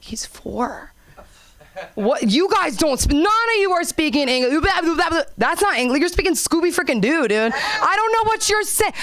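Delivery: high, rising mock outrage at the kids; mock exasperated counselor. Counselor scolding the kids, hammed-up high exasperation. Mocking kids who can't speak English.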